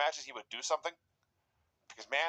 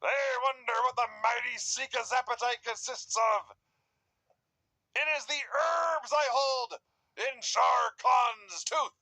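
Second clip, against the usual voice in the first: wizard promo voice; 8 semitones higher, slower than usual. Retold or imitated. Mocking the Wizard's promo, grandly declaiming. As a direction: boom it out like a mystic manager. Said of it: "quoting the Wizard's promo".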